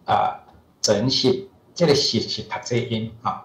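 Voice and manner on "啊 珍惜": 珍惜 said slowly as the Hakka literary reading; 珍惜 drawn out in its Hakka reading. Shown as a demonstration. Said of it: "客语念作".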